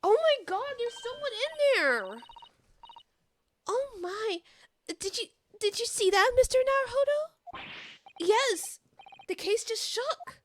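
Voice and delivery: high, alarmed gasping words; startled high voice. Acted. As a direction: react to the shaking case in alarm. Assistant startled by moving case.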